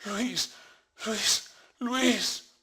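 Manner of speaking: high, desperate calling of a name; a widow crying out for her lost son; anguished cry. A narrator's reading. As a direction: cry out like waking from a nightmare.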